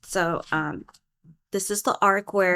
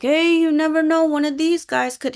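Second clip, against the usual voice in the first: high, louder teasing voice; Perry needling Skiff, high teasing voice; teasing quip; 8 semitones higher, 5 dB louder than usual. Retold or imitated. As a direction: toss it off playfully, pitched high. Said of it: "And she's like".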